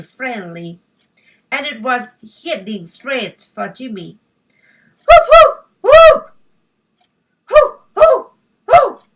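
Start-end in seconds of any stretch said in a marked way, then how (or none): 5.1-9.0 very high, loud frantic puppy barks; puppy warning his owner of the snake; panicked barking. Acted. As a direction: bark in rising panic. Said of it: "barked Pepper, beginning to panic".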